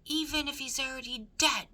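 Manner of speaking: loud, high shout; Cook shouting. A narrator's reading. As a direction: yell it across the room. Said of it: "shouted Cook".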